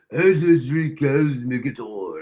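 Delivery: low, slow, snooty mock-British accent; nose-in-the-air British. Host mimicking Clever's refusal, haughty mock-British accent. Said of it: "she had her nose in the air".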